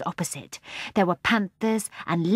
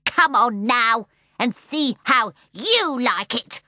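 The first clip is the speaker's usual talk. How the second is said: slow, louder, sneering taunt; bitter seal voice; 5 semitones higher, 5 dB louder, slower than usual. A narrator's reading. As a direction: drag it out with a leer. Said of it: "bitter, angry voice".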